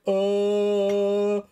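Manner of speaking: mocking, high, dopey employee impression; clueless employee. Employee calling to coworkers over a simple customer question, clueless mockery. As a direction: act out a baffled worker, high and drawn out in places. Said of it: "look at him like he's a fucking piece of meat and just like".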